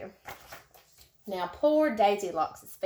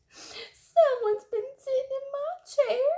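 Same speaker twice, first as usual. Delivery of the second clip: tiny squeaky cub voice, much higher; wee little voice. A narrator's reading. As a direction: squeak it like a tiny baby bear. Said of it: "in his wee little voice".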